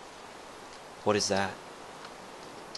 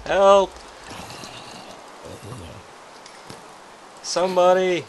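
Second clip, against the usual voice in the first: calling out; 8 semitones higher, 3 dB louder than usual. Acted. Calling out for help.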